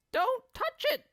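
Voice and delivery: put-on very high old woman cry. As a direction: shriek a sharp warning. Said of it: "cried Missus Twit".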